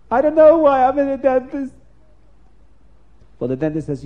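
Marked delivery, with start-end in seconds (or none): 0.1-1.7 high, loud, helpless cry; bewildered outburst. Acted. The man tells the dentist he has no idea why he came, upset outburst. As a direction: blurt it out, lost and upset.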